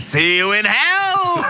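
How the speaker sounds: high, drawn-out theatrical yell; hammed-up battle cry. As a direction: holler it like a doomed game hero. Hammed-up cutscene hero's last cry.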